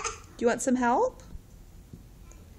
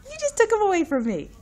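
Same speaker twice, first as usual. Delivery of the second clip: mock outrage; 14 semitones higher, 5 dB louder than usual. Mock complaint to toddler.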